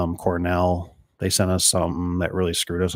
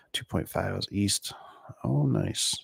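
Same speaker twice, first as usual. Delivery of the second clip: breathy whispered aside to himself; 8 dB quieter, slower than usual. Real, not acted. Installer whispering chat comments to himself, whispered. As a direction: whisper it like reading under your breath.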